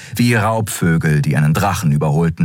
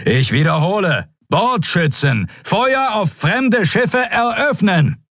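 even higher, slow, insistent; repeated shouted order; slower than usual. A narrator's reading. Admiral repeating the unanswered order, more insistent and shouted. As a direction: hammer the order home, slower and harder.

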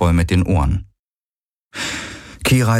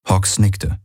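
ordinary delivery

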